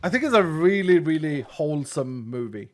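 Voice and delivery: usual speaking voice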